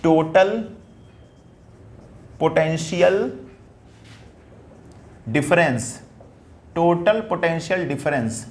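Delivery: slow, word by word with long pauses; teacher dictating a term, slowly; slow dictation. Shown as a demonstration. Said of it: "तो मैं यहाँ क्या लिखूंगा".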